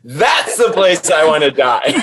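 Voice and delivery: breaking into real laughter mid-sentence; laughing. Real, not acted. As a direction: say it through helpless laughter. Laughs at her own dark wish.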